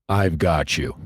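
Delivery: steady, commanding reassurance; voice of pure authority. Retold or imitated. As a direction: speak it as a voice of absolute authority. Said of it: "It was the voice of pure authority".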